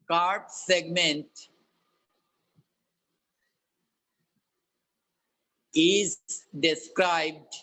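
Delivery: slow, clear dictation with long pauses; dictating. Acted. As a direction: dictate each word slowly for note-taking. Teacher dictates the problem statement.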